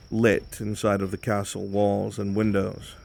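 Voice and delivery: ordinary talk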